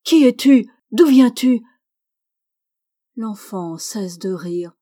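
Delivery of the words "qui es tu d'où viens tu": loud, higher, harsh shouting; old man yelling in anguish, getting louder. A narrator's reading. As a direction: bark at the child to stop. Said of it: "Il crie plus durement encore".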